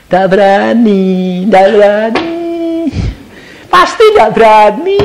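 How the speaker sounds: building taunt. Acted. Mocking the congregation's fear.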